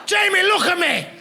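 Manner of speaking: high laughing call across the room; mock shouting at audience member. Real, not acted. Comedian calling out to Jamie, high laughing shout. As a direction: playfully yell over the laughter.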